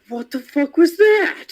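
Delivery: high, slow disbelieving outburst; gamer rage. Retold or imitated. After an instant death in Smite, a high outraged outburst. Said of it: "it's a form of rage".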